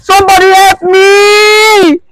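slow, high wailing scream through sobs; crying scream. Acted. Wife screaming for help over her husband, wailing cry. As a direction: howl for help in tears.

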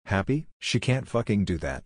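ordinary delivery

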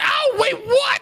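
high-pitched startled cry; startled yelp. Acted. Rainy hit while testing minecarts, startled. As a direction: yelp in hurt confusion.

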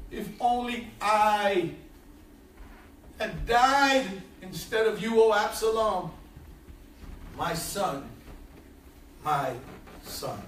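low, soft, fading lament; grieving father. Acted. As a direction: let the grief sink away to almost nothing. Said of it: "wept as he said".

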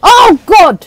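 sharp high shout of alarm; pained yelp. Real, not acted. Gamer yelling at a sudden hit, high and loud shout. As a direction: cry out as the hit lands. Sudden pain in game fight.

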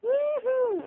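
high, loud woohoo; bright celebratory shout; excited cheer. Real, not acted. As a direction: let out a happy whoop.

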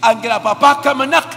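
loud, driving sermon delivery; preaching at full force; fervent preaching. Real, not acted. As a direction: press the counsel home. Preaching the parents' counsel.

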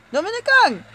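high, loud call. Real, not acted. Player calling to Dominicán in the game, calling out.

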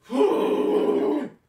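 high, loud breathy groan; real frustrated sigh; loud exasperated sigh. Real, not acted. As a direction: let out a long dog-like whine of frustration. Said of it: "I sound like a dog or something".

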